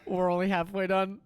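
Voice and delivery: giggling punchline; barely holding it together; laughing. Real, not acted. Laughing at own story.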